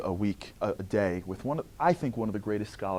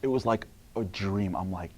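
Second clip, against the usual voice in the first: normal talking voice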